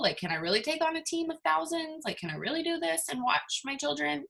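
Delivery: high, soft worried questioning; mock fearful doubt. Voicing the audience's fear of success, high anxious doubt. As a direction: pitch it up like an anxious self-doubter. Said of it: "you're afraid or you're fearful".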